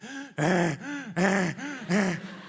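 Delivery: low, wild gasping snort; ragged breathless wheeze; wheezing boar snort. Retold or imitated. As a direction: imitate a wounded boar wheezing. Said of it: "I could clearly hear it sound like this".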